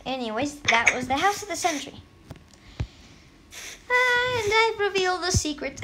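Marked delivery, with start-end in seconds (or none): 3.5-5.9 high giggly reveal breaking into laughter; laughing. Real, not acted. Gamer laughing at his own slip. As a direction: laugh through the confession. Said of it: "Hey, why did I laugh?".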